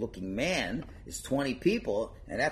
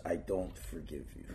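low stern refusal; 6 semitones lower, 7 dB quieter than usual. Acted. Rabbi refuses again.

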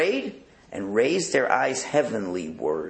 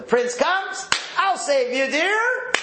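much higher hammed-up heroic rescue; mock heroic prince; 3 dB louder, much slower than usual. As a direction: play the gallant savior over the top.

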